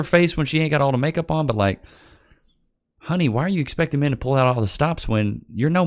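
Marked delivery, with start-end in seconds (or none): none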